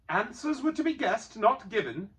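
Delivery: annoyed Bilbo. A narrator's reading. Annoyed Bilbo arguing the rules with Gollum. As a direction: insist he won fairly.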